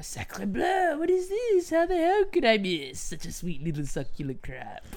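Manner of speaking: high hammy French chef voice. Retold or imitated. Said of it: "reminds me of the Little Mermaid".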